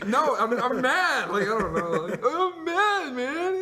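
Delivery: strained high voice, mock tearful anger; high mock crying. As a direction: whine it out like a furious crier. Acting his angry crying self.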